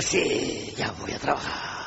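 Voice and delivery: soft, groaned, breathless mock reply. Dad groaning an answer to his child, softly strained. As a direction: grunt it out like a weary worker. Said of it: "la cultura del pujido".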